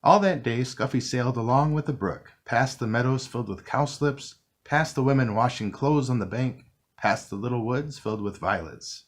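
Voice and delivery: usual speaking voice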